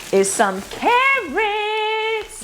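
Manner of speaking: high, slow drawn-out carrots; playful reveal. Pretend. Showing carrots to viewers, high drawn-out. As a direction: stretch it out high.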